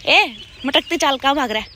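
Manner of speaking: calling the dog. Real, not acted. Calling the street dog over.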